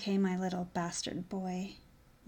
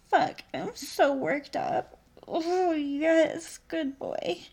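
higher, louder, slowed moans; rising arousal; 8 semitones higher, 8 dB louder, slower than usual. Acted. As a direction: let the excitement build.

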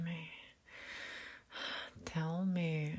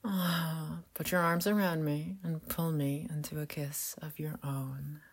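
sultry whisper. Acted. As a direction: whisper it close and teasing. Seductive whispered roleplay throughout.

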